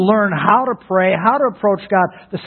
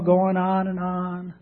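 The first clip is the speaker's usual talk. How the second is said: lightly sing-song, slow and soft; mock droning; 6 dB quieter, slower than usual. Shown as a demonstration. Preacher mocking long-winded prayer, slow sing-song drone. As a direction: drag the words out like endless empty prayer.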